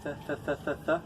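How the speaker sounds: soft "th" with the tongue between the teeth, repeated; gentle "th" repeated with the tongue tip between the teeth; soft th sounds. Shown as a demonstration. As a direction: breathe out a light "th, th" with no push. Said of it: "I'm putting my tongue between my teeth".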